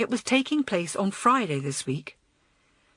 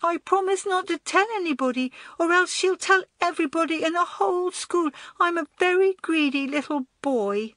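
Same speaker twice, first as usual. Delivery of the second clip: put-on high, anxious child's voice; scared small boy; 11 semitones higher than usual. A narrator's reading. Small boy fears older girl will tell, scared. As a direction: blurt it like a frightened little boy. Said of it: "Rupert looked scared".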